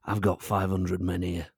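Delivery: lightly voiced gruff union leader; rally the men like a union boss; trade union boss. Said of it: "our trade union boss is more".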